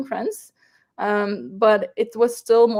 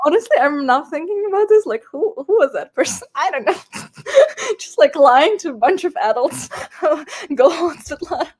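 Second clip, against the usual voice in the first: laughing through words; 6 semitones higher, 5 dB louder than usual. Real, not acted. Embarrassed laughing recalling her lie.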